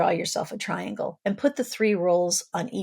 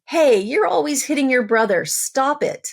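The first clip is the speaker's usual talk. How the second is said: loud, higher scolding shout; angry parent scolding; 6 semitones higher, 5 dB louder than usual. Retold or imitated. Parent storming in on fighting siblings, angry loud scold. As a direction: burst in and scold the kid. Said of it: "you storm into the room saying".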